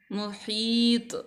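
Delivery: मुहीत drawn out slowly with a clear echo at the end; slow recitation. Shown as a demonstration. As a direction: stretch the word, letting the त ring. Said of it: "थोड़ा सा ना झटका दे के".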